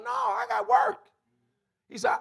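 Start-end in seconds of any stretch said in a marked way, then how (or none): 0.0-1.0 son's shouted reply. Retold or imitated. Son's high shouted retort.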